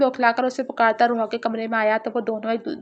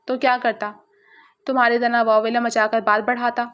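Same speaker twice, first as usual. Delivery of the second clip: ordinary delivery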